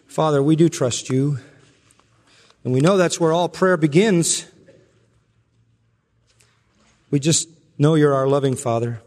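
earnest spoken prayer voice; pastoral prayer. Acted. Pastor praying for the congregation, earnest and reverent. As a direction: pray aloud for the whole church.

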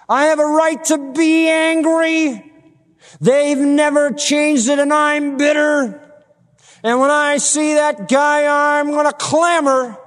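high, drawn-out mock grievance; acted indignant protest. Voices an aggrieved objector protesting.

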